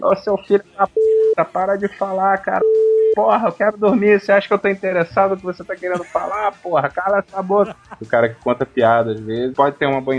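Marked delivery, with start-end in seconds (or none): 0.0-7.7 bang on the door and yell at him; angry passenger yelling. Retold or imitated. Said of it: "começou a bater na porta da cabine". (passageiro furioso gritando)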